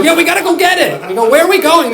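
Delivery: high, loud eager voice; rally the crowd to go; hyped-up gullible voters; mock eager voters. Voters swept along.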